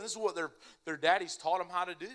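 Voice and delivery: ordinary delivery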